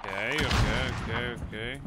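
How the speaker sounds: shouted rapid reassurance; loud hurried okays. Real, not acted. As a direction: yell it back quickly. Answering a teammate yelling at him.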